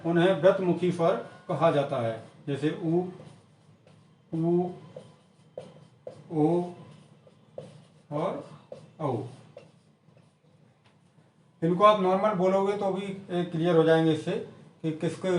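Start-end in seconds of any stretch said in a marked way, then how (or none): 2.5-9.2 उ ऊ ओ औ sounded with rounded lips; vowel demo. Shown as a demonstration. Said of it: "होंठ गोल हो जाते हैं".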